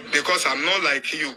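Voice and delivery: usual speaking voice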